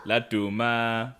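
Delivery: normal talking voice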